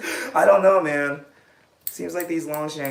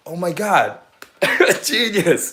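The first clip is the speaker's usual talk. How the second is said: louder and higher, thrilled; excited exclamation; 5 semitones higher, 9 dB louder, slower than usual. Real, not acted. Reacting to her own genius idea, excitedly. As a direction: burst out in delight.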